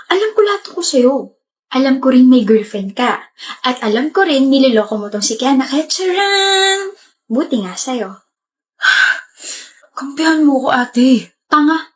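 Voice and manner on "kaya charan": gleeful reveal. Acted. Sister reveals the setup.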